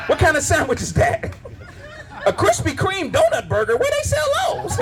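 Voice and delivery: breathless high giggling questions; laughing mock flirt. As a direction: flirt with the sandwich while cracking up. Flirting with a sandwich.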